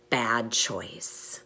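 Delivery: usual speaking voice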